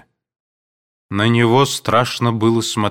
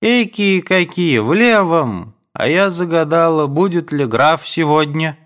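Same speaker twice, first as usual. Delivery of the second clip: high, loud, bright mother's chatter; chatty mother; 8 semitones higher, 4 dB louder than usual. A narrator's reading. Mother calls from her room about the count, high and loud. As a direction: play a fussy mother calling out.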